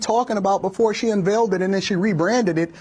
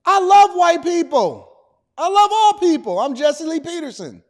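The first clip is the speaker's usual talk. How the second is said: loud high proclamation; 6 semitones higher, 4 dB louder than usual. Acted.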